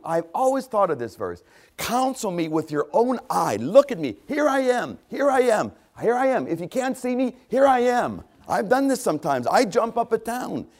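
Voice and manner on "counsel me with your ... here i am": high-pitched plea to be seen; eager calling out. Acted. Preacher playfully calling on God to look, eager high calling. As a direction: call up high, waving for attention.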